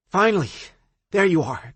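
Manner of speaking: high, loud exasperated friend; fed up, finally found her. Acted. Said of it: "exasperation written all over her face".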